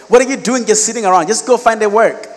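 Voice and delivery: high-pitched, impatient imitation; mock scolding. Privileged person to jobless graduate, impatient scolding. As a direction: rattle off a dismissive telling-off.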